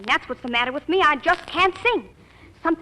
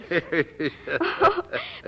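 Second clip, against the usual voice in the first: giggle; 8 semitones lower than usual. Acted. Girl giggles with grandpa.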